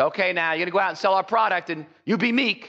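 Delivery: high, bossy pep talk to the sales force; rally the troops as a boss, half joking; mock sales boss. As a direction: executive lightly voiced, rousing his salespeople. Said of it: "executives don't get a sales force".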